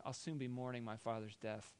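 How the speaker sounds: low, hushed acted threat; soft, menacing undertone; scheming. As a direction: plot it quietly to yourself. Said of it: "Esau began to scheme".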